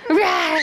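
a quick silly growl; playful monster roar. Pretend. Mom roars as monster at toddler.

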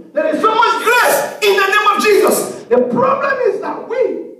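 high, ringing pulpit cry; fervent preaching. Acted. Preacher proclaiming grace in the name of Jesus, fervent preaching. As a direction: cry it out to the congregation.

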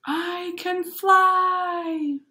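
high, very slow proclamation; dreamy excited boy. Acted. The boy announcing he can fly, high and very slow. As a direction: stretch out the wonder.

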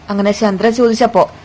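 usual speaking voice

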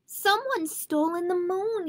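high-pitched alarmed cry, held in volume; mild shout. Acted. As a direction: shout it lightly, pitched well up. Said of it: "he shouted".

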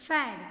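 ફેડ said as one loud, clear syllable; pronunciation demo. Shown as a demonstration. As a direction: model ફેડ loudly for pupils. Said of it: "કૌંસમાં એનો ઉચ્ચાર છે".

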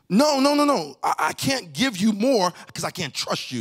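emphatic refusal. Acted. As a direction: refuse him outright, almost shouting. Voicing God refusing, shouting.

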